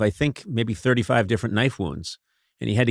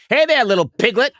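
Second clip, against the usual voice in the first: much higher, hammed-up greeting; mock sweet-talk; 12 semitones higher than usual. Comic pretending to greet his wife tonight, high mock-flirty call. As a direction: call out to her in a silly high voice.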